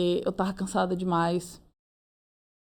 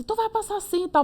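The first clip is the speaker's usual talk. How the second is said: lightly voiced friend, pitched higher; a bright quick reassurance; friend reassuring; 12 semitones higher than usual. Friend reassuring the speaker she'll pass, higher-pitched. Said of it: "E aí ela foi e disse".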